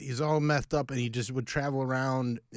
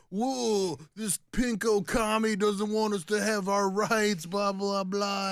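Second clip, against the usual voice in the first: mocking; 6 semitones higher, slower than usual. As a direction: parody indignant harassers. Mocking his online harassers.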